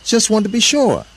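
high, loud reply; calling back. A narrator's reading. Hidden Eve reassuring herself, high loud call back. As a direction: sing out the answer at high pitch.

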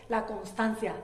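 usual speaking voice

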